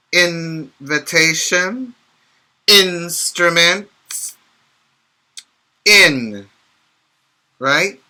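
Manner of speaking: ordinary talk, nothing marked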